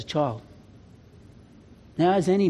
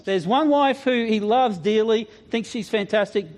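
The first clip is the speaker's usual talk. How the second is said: usual speaking voice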